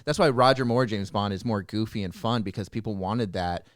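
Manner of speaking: ordinary talk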